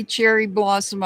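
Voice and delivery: usual speaking voice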